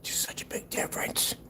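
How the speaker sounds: whispered dry aside; whispered mock awe; sarcastic whisper. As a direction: breathe the mockery out. Sarcastic aside under breath.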